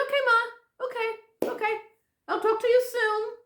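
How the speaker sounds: high-pitched string of okays; re-enacted high, hurried goodbye; placating daughter. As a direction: give in to mom in a high voice.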